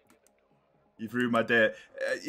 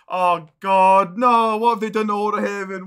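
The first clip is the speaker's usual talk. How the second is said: loud, higher cry of dismay; dismayed outcry; 5 semitones higher, 8 dB louder than usual. Real, not acted. Streamer horrified at the map change to chat, loud dismayed cry. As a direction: shout it in disbelief at the news.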